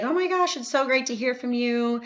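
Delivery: high, gushing "Oh my gosh"; gushing excitement. Shown as a demonstration. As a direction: squeal with delight at hearing from them. Sample reply to interested friend.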